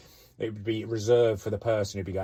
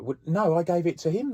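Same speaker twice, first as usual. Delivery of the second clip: flustered protest; 10 semitones higher, 7 dB louder than usual. Retold or imitated. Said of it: "he'd be like".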